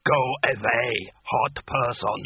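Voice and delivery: slow, broken giant voice; slow ice giant. A narrator's reading. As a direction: speak like a huge ice creature.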